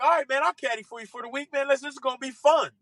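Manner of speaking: high, upbeat retold enthusiasm; eager agreement. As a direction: agree eagerly, pitch lifted all through. Retold eager offer to caddy.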